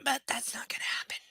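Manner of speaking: breathy whispered aside. Real, not acted. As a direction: whisper the comment to yourself. Dry aside after a long pause.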